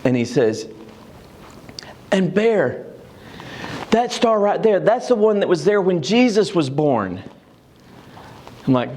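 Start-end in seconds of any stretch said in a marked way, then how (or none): 2.1-7.2 put-on high, eager little boy explaining; a grandson insisting to grandpa, raised a bit higher; lightly voiced excited child; child's voice. Grandson's voice in retold story.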